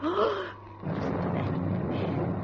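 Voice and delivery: gasp, then a breathy, hushed, slow listen; startled gasp at the thunder; frightened gasp. Acted. Aunt gasps in fright at the thunder, hushed.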